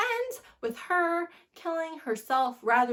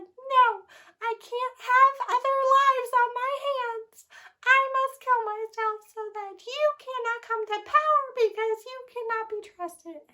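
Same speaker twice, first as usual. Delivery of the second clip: much higher and louder, a noble mock martyr; mocking heroine; 13 semitones higher, 5 dB louder than usual. Reviewer mocks the heroine's noble speech in a high voice. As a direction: ham up the self-sacrificing heroine. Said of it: "you're just like".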